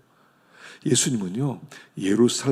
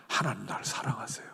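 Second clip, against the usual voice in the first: very soft whisper to God; whispered prayer; 2 semitones lower, 9 dB quieter than usual. Acted. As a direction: breathe the words out as a whisper. (속삭이는 기도)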